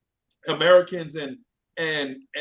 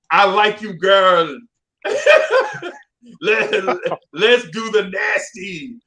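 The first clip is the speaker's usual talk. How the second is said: blunt Jamaican dude; 5 semitones higher, 9 dB louder, slower than usual. Retold or imitated. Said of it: "Jamaican gonna be like".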